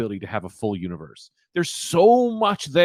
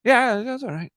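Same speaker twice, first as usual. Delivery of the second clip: lukewarm approval; 9 semitones higher than usual. Retold or imitated. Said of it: "and I went".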